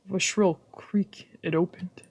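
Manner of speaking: ordinary talk, nothing marked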